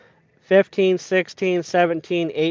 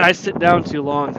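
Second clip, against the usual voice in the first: normal talking voice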